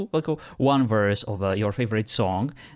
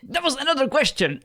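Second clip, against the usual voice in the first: much higher, mock-outraged gotcha; exasperated exclamation; 13 semitones higher than usual. Real, not acted. User catches the AI breaking his rule, high mock outrage. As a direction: call it out like catching a cheater.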